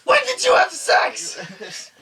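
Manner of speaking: very high, loud, drawn-out mock outrage; shocked mother. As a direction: screech it like an appalled mom. His mom's shocked question, acted out.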